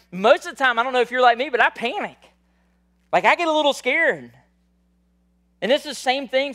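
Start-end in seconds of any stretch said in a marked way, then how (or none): 0.1-4.3 loud, high preaching climax; preaching at full force. Real, not acted. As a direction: lift it up and preach it out. Preacher builds to loud peak.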